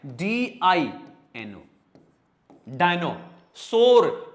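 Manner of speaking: डायनासोर spelt D-I-N-O then said slowly; slow spelling. Shown as a demonstration. Teacher spells डायनासोर for pupils. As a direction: sound out डायनासोर letter by letter. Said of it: "डीवाई नहीं करना है".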